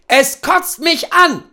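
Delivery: high, slow, forced-out disgust; angry outburst. Acted. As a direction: spit it out in pure fed-up rage. Husband blows up at breakfast.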